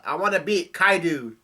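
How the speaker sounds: yelling out. A narrator's reading. Child Momo declares his wish to beat Kaido, yelling out. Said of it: "Momo yells out".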